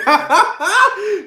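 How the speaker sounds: big high-pitched cackle; high cackling laugh. Real, not acted. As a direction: burst out laughing at the joke. Laughs after own joke.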